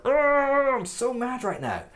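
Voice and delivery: high mock exasperated groan then venting; hammed-up fuming after a hard day; mock frustrated outburst. Teacher imitating someone fuming at home, mock frustrated. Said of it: "you're like".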